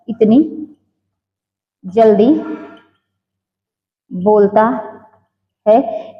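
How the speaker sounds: word by word with long pauses; slow dictation, leaving time to write. Real, not acted. Teacher dictating notes to students, dictation.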